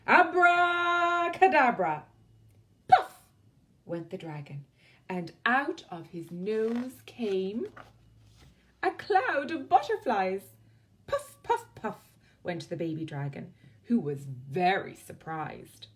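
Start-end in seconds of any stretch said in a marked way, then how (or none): 0.1-2.0 high, loud, slow spell shout; witch's shout. Acted. 2.9-3.2 sharp, very high, loud puff. A narrator's reading. Dragon puff sound. 11.1-11.6 puffing. A narrator's reading. Dragon puffing.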